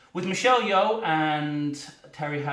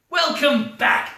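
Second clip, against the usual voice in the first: higher, louder, slow welcome; mock M greeting; 8 semitones higher, 6 dB louder, slower than usual. M welcoming her agent back, drawn-out mock greeting. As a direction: stretch it like a stern boss.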